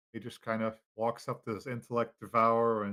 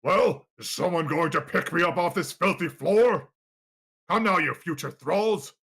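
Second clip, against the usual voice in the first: loud, imperious, higher-pitched master; commanding voice; 4 semitones higher, 6 dB louder than usual. Acted. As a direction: order your servants about. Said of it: "you hear a deep reverberating voice".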